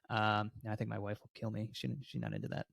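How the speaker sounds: normal talking voice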